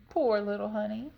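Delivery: plain reading voice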